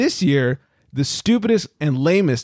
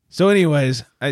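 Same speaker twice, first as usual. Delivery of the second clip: normal talking voice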